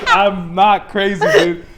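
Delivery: loud, slow, emphatic denial; insistent protest. Retold or imitated. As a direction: push back firmly, stretching the words. Insisting to a doubting cop.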